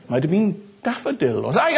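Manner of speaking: ordinary talk, nothing marked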